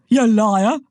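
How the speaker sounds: loud indignant snap; angry retort. Acted. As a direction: fire it right back. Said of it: "Fuga shot back".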